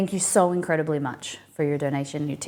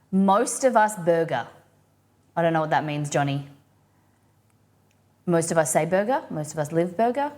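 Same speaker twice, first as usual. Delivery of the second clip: normal talking voice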